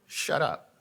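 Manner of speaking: a no-nonsense inner voice telling him to hush; stern warning. Retold or imitated. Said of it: "no, he said".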